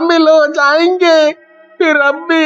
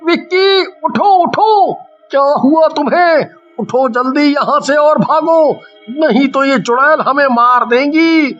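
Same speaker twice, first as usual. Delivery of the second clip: higher, pleading panic; urgent alarm; 8 semitones higher than usual. Acted. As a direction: rouse a fainted friend in alarm. Urging fainted friend to flee witches. (घबराकर दोस्त को जगाती ऊँची आवाज़)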